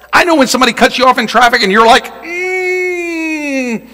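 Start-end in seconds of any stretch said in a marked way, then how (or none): 2.2-3.9 long, high, strained "mmm"; mock pent-up whine; frustrated squeal. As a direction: hold in road rage as a high hum. Mimics irritation at being cut off.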